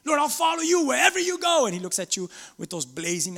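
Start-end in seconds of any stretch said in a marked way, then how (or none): 0.0-1.7 high, loud, fervent declaration; eager would-be disciple. Acted. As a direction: proclaim it to the Lord with full zeal. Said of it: "pretend that's you".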